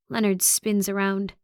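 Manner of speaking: ordinary delivery, nothing marked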